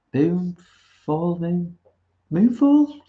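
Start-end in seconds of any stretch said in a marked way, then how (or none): 2.3-3.1 high, loud blurted title; excited. Real, not acted. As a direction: shout the title out, much higher.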